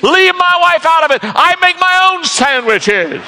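high, loud outburst, then slowing; indignant worker. Acted. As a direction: snap back defensively. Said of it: "He said".

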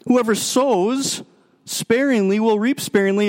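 emphatic preaching. Acted. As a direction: stretch each word so it lands. Preacher repeats verse emphatically.

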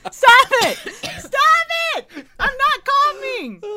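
high, loud protest through laughter; laughing shout. Real, not acted. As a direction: yell at them while choking with laughter. Choking on drink, others laughing.

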